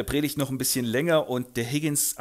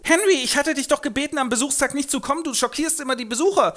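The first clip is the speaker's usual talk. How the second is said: hoch und schnell, vorwurfsvoll; tadelnde Mutter; 12 semitones higher, 4 dB louder, faster than usual. Acted. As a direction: eine Mutter schimpft ihren Sohn. (hohe, vorwurfsvolle Mutter)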